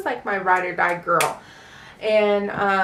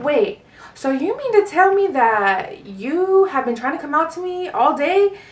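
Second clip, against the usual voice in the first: incredulous mom; 6 semitones higher, 4 dB louder than usual. Retold or imitated. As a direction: imitate an astonished mother demanding confirmation. Mom grasps daughter's confession.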